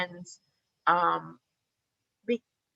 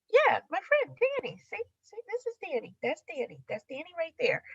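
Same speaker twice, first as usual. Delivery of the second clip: much higher, breezy and naive, quick on see see this is Danny; innocent showing off; 7 semitones higher, 4 dB quieter than usual. Retold or imitated. Wife showing husband her artist friend, high and naive. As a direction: proudly present your friend, clueless.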